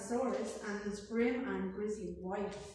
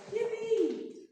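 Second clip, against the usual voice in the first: a much higher, squealing "Yippee!"; gleeful cry; 11 semitones higher than usual. A narrator's reading. Young dinosaurs cheering the fallen tree, gleeful high cry. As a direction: leap up with delight. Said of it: "the tees cried out".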